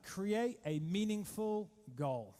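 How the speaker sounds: slow and emphatic, each word spaced; slow dictation. Shown as a demonstration. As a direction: dictate it so they can write it down. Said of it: "Please write this down".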